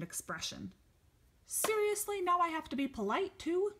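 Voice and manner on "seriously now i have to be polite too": indignant squeak of protest; high indignant whine. Acted. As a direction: whine it out, offended. Norwegian dinosaur protests.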